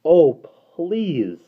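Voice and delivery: high, loud beseeching plea; desperate girl begging. Acted. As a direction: beg the creature not to leave. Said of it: "besought him".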